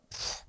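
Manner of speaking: short, soft scoffing puff of air; dismissive pfft. Retold or imitated. Imitating listeners brushing off a working class voice, dismissive pfft. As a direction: blow it off with a pfft. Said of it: "they immediately go".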